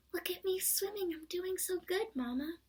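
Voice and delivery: squeaky Roo. Acted. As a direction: boast to Mama in a squeak. Roo boasting to mother.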